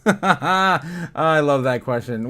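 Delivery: laughing as he answers the chat; real laugh running through the words. Real, not acted. Viewer asks when Banana Zone.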